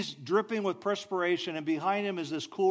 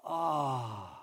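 soft, low satisfied ahh; a quiet happy exhale; contented ah; 5 semitones lower, 4 dB quieter than usual. Shown as a demonstration. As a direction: sigh it out, fully content. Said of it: "That's what contented people say a lot".

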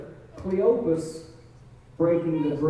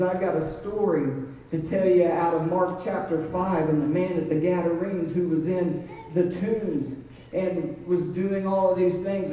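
ordinary delivery

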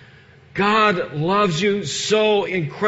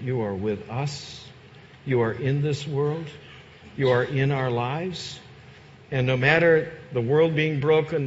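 soft, lower, hushed prayer voice; closing prayer; 9 semitones lower, 8 dB quieter than usual. Acted. Pastor leading the congregation in prayer, softly and humbly. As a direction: pray gently and humbly for them.

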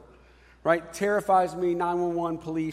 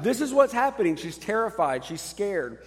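ordinary talk, nothing marked

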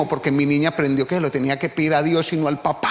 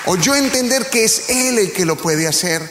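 preaching; 5 semitones higher than usual. Acted. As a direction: preach it with rising fervor. Preaching rises in intensity. (predicando con fervor, voz más alta)

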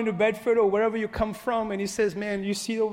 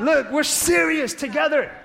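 slow, loud, higher-pitched cry to God; shouted declaration; 7 semitones higher, 7 dB louder than usual. Acted. Congregation declaring to God, slow loud shout. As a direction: stretch it out and shout it.

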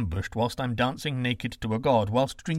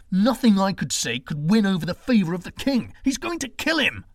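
high, loud anguished outburst; bitter despair; 8 semitones higher, 6 dB louder than usual. Acted. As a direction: rage breaking into despair. Son despairs about brother.

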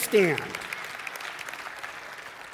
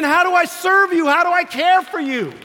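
a hint of an eager servant, higher and louder; humble servant; 9 semitones higher, 7 dB louder than usual. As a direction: offer help with raised eager voice.

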